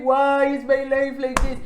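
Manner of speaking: lightly voiced deadpan whine; deadpan Ethan. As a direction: imitate a bored, droning hero. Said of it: "he's still all deadpan".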